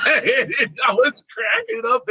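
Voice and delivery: real laughter through the words; laughing. Real, not acted. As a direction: say it while cracking up. Laughing while retelling.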